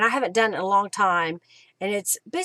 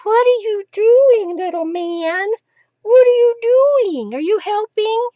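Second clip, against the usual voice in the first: puppy talk; 12 semitones higher, 8 dB louder than usual. Real, not acted. Crafter asks her puppy if he's helping, high cooing. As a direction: gush at the puppy on your lap.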